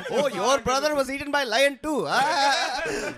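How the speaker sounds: high-pitched mock African accent, laughing. Echoing a friend's lion joke, high mock African accent. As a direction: play along in a hammed-up, high African accent. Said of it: "See, there's the accent".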